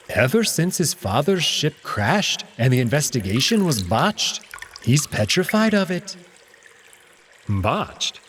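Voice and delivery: hint darkly at the crash as the cat; Alex, cat explaining. Acted.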